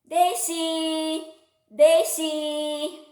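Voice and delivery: high, loud sing-song call; little girl calling her dog Daisy, high and singing. Acted. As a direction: sing the name out across the hill. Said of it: "the girl called".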